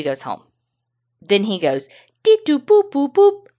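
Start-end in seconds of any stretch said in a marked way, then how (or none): 2.2-3.5 high sing-song phone beeps, louder; mock dial tones. Jose dialing the police, high sing-song beeps. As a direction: chirp the keypad tones up high.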